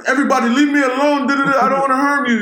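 high-pitched mock Middle Eastern accent. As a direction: plead quickly in a comic Middle Eastern accent. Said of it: "he got this crazy Middle Eastern accent".

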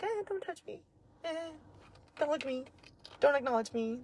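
high, small voice pushing people away; scared guarded voice. Retold or imitated. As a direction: squeak it out like a frightened dog. Acting out her guarded self.

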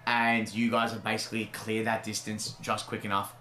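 usual speaking voice

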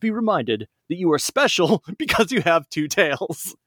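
high, proud cartoon hero voice; Sonic voice. Sonic giving the little fox his nickname, high hero voice. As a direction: bestow the nickname like a big brother. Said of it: "Sonic speaking to Miles".

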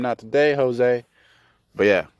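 normal talking voice

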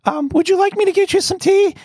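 high-pitched mock gushing welcome; syrupy mock hospitality; gushing host. Mocking a gushing tea host.

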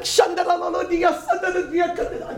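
hammed-up tongues, loud and high then drawn out slowly; mock babble of tongues called out, then slowed; praying in tongues. Friends praying in tongues loudly at Epcot, hammed-up babble. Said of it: "Can we get an interpreter for that".

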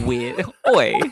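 nerdy voice. Pretend. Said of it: "I'm getting into my, like, nerdy, geeky voice".

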